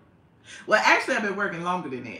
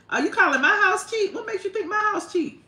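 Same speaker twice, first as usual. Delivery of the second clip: higher, louder playful indignation; mock offense; 14 semitones higher, 5 dB louder than usual. Real, not acted. To a commenter who called her house cheap, mock outrage. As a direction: act insulted but clearly teasing.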